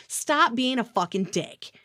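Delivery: usual speaking voice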